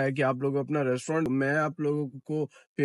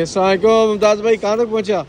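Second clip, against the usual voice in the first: loud, raised-pitch hail; calling out greeting; 6 semitones higher, 7 dB louder than usual. Real, not acted. Vlogger to cook asking how far along, calling out. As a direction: call across to a busy co-worker. Calls out to a co-worker.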